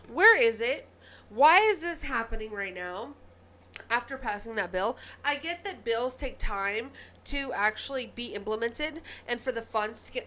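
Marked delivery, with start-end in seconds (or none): 0.1-5.0 heated rant. Real, not acted. Ranting about derailments.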